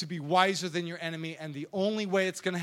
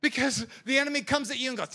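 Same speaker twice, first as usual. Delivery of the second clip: ordinary talk, nothing marked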